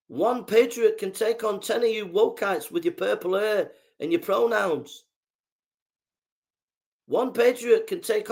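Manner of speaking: high-pitched, forceful rant; heated warning. Real, not acted. Patriot warns opponents to pull up, heated. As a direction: warn them urgently, pitched well up.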